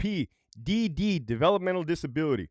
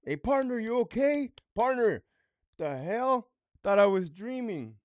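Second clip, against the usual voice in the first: dazed mock officer; 5 semitones higher, 5 dB quieter than usual. Dazed officer after assault.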